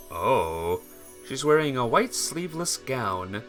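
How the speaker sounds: ordinary talk, nothing marked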